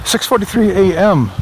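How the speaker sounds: upbeat announcer reading the time; radio DJ voice. Real, not acted. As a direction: call out the time on air. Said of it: "I sound like a radio D J".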